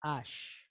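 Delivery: ash said slowly on its own; sound ash. Shown as a demonstration. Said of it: "give us the sound".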